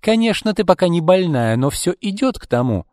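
high, emphatic mocking tone; spiteful acted needling; snide taunt. A narrator's reading. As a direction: taunt her with mock agreement. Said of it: "ехидно сказала Марго".

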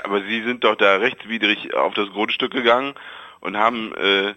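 ordinary talk

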